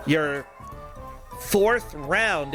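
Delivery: ordinary talk, nothing marked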